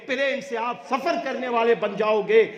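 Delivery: ordinary talk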